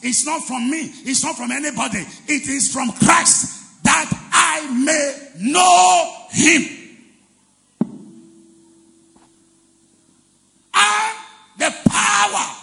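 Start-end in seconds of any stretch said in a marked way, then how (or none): 2.3-12.6 loud, soaring preaching, words stretched; preaching climax. Acted. Pastor exalting resurrection power to the church, soaring preaching. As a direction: build the power line by line.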